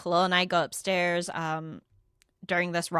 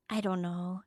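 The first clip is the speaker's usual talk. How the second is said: mock noncommittal shrug; evasive mumble; 2 semitones lower, 7 dB quieter than usual. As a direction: imitate a softly evasive reply. Questioned friend gives evasive answer.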